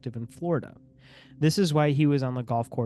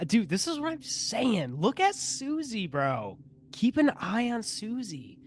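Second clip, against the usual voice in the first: much higher, half-joking conspiracy tone; high mock-paranoid voice; 8 semitones higher than usual. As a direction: whip yourself up about Susie, pitched high.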